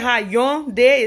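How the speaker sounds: Hyundai in three beats, "ha-YON-day"; slow, high Hyundai with the Y sounded; insisted mispronunciation. Retold or imitated. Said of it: "You're saying it wrong".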